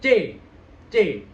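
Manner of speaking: loud, open "C"; C agak dibuka. Shown as a demonstration. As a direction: punch out a clear "C". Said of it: "Jadi agak dibuka". ("C" keras, mulut agak dibuka)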